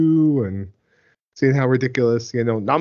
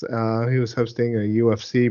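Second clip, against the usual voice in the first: normal talking voice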